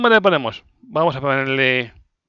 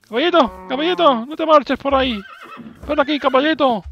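calling out; 11 semitones higher than usual. Real, not acted. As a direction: call the horse to come. Calling horse.

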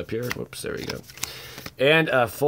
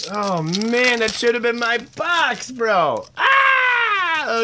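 much higher, louder shout; excited yell; 11 semitones higher, 4 dB louder, slower than usual. Real, not acted. The friend pulls a case hit, shouting.

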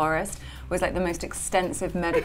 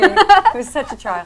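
laughing; 8 semitones higher, 12 dB louder than usual. Real, not acted. Mock complaint, laughing.

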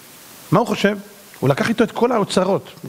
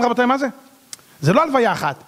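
ordinary talk

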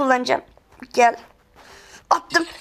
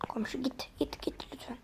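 much softer pleading whisper; 18 dB quieter than usual. Real, not acted. Gamer hiding, begging the neighbor to go, whispered. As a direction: beg him to leave without a sound.

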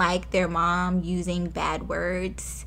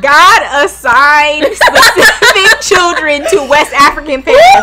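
loud, laughing protest; laughing shout; 7 semitones higher, 14 dB louder, much slower than usual. Real, not acted. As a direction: yell it while cracking up. Laughing while protesting a joke.

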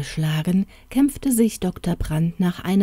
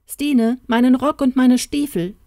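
calling out; 6 semitones higher, 4 dB louder than usual. A narrator's reading. Doctor calls housekeeper from hall.

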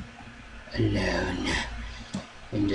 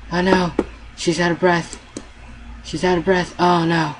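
alarmed; 7 semitones higher, 3 dB louder than usual. Real, not acted. Game character out of breath, panic.

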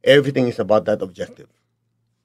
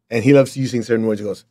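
ordinary talk

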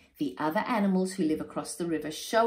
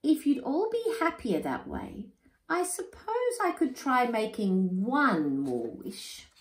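thoughtful; 7 semitones higher than usual. Acted. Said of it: "says Ngiri thoughtfully".

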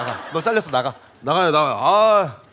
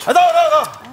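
angry yelling; 7 semitones higher, slower than usual. Real, not acted. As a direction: yell at the useless extras. Director loses his temper.

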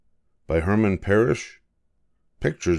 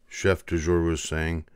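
ordinary delivery, nothing marked

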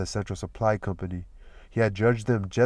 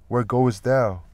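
louder friendly greeting; calling out; 3 semitones higher, 6 dB louder, slower than usual. Acted. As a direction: call out to a friend. Pablo greets Danny.

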